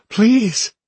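high, loud desperate plea; begging. A narrator's reading. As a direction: cry out in pleading need. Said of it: "she begged".

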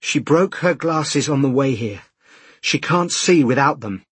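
urgent pleading. A narrator's reading. Man begging official for the child, urgent pleading. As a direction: press the case desperately.

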